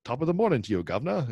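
a hammed-up old British greeting; mock British accent. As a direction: tip your hat like a period drama gent. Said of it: "British shows where they're like".

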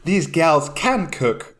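mock English accent, "gals" for girls; Jamie Oliver. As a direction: put on his English accent, drawn out. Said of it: "he says gels".

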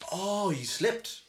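slow, high, drawn-out doubting "oh"; mock disbelief, stretched out; sarcastic boss. Boss doubting the cleaner's slip story, sarcastic. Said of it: "And he went".

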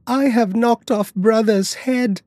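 put-on high-pitched distressed little girl; terrified child crying out. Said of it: "she ran crying and screaming".

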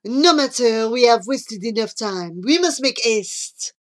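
urgent French suitor; Jean-Claude. Acted. Jean-Claude done with the gum, urging Charlie to hurry. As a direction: press on with French-accented impatience.

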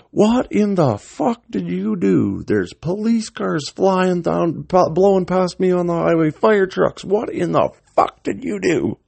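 high-pitched, drawn-out mock tirade; acted-out outraged yelling, voice held way up high; imitated screaming dad. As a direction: rant like a father screaming down the phone. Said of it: "he's screaming at me on the phone".

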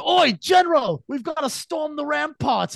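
high, loud mock English soldier; urgent comic English battle cry; English accent. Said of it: "they've got English accents".

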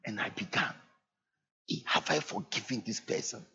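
quiet personal admission; hushed confession. Real, not acted. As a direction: confide it quietly to the congregation. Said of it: "confessing his own fear".

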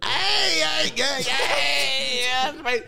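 loud hey calls. Real, not acted. To his mate, shouting him down with loud heys. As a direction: cut in hard with a run of loud, high heys. Friends ribbing each other loudly.

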